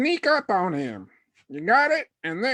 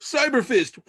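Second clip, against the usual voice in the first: ordinary delivery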